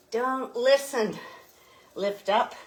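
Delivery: normal talking voice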